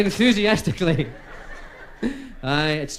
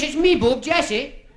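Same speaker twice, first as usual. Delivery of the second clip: high wifey voice; 8 semitones higher, slower than usual. Acted. Wife voice in joke.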